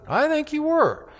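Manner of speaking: very high, held sing-song taunt; mocking servant girl. Servant girl taunting Peter a third time, very high mocking voice.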